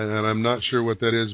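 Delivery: normal talking voice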